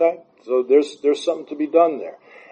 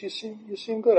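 "You seem kinda happy" in a higher, softer, lightly voiced mom; a gentle mom's voice, pitched up and hushed; imitating mom; 10 semitones higher, 7 dB quieter than usual. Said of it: "She goes".

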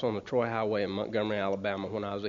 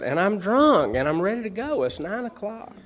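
normal talking voice

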